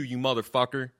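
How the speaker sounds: normal talking voice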